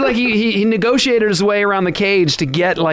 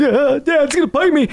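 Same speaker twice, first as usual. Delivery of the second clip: put-on scared kid voice; 9 semitones higher than usual. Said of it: "he was all terrified".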